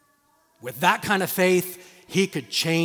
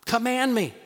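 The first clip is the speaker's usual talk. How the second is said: high, loud eager demand; bold Peter; 7 semitones higher, 6 dB louder than usual. Retold or imitated. Peter calling to Jesus on water, loud eager plea. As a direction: call out boldly like brash Peter. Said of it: "like he normally does".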